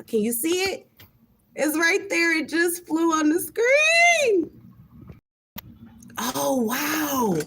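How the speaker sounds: high, loud excited cry. Real, not acted. To viewers as the ladybug lands, excited high cry. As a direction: show viewers the ladybug, thrilled.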